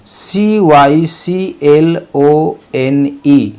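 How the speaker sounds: letters spelled one by one, very slow; careful slow spelling. Shown as a demonstration. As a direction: spell it slowly for students. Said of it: "spelling out letters".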